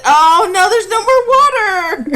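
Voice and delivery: playfully voicing the dog with a hammed-up sob; mock sad dog voice. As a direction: whine it like a sad thirsty dog. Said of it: "He said".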